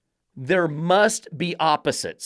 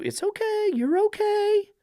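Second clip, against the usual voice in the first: high, soft sing-song "it's okay"; mocking cooing reassurance; 3 dB quieter than usual. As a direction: mimic a cooing dog owner, mockingly. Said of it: "with that tone of voice".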